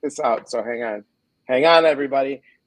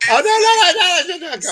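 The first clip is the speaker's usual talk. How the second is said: laughing panic; 9 dB louder, faster than usual. Real, not acted. Tech panic before playing clip.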